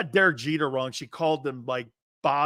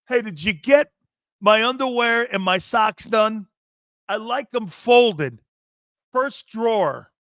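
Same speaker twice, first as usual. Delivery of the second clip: loud, higher mock domineering host; imitating radio host; 5 semitones higher, 6 dB louder than usual. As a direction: mimic a man ordering up his laundry. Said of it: "So he's like this".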